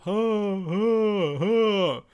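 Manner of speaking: imitating a smug little stage laugh; Jimmy Carr's laugh. Mocking a comedian's signature laugh, a high mock cackle. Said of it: "He does that little laugh".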